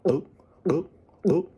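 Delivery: whispered moose grunts; moose mating call. Retold or imitated. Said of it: "they go".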